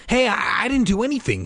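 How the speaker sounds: put-on boy shouting his innocence at his sister. A narrator's reading. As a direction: blurt it out defensively. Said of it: "he shouted".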